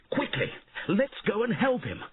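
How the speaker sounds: urgent. A narrator's reading. Urging Kara to help.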